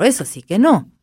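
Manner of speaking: usual speaking voice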